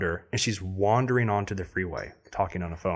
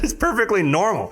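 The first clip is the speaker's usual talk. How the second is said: mock policeman; 11 semitones higher, 5 dB louder than usual. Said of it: "He said".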